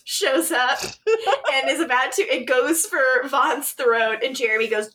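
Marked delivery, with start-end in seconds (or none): none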